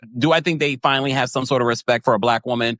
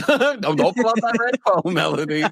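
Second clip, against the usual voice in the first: mock warning; 9 semitones higher than usual. Teasing about red phone.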